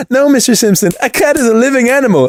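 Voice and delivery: high, fast prim scolding; babysitter voice. Retold or imitated. Babysitter voiced in retold scene.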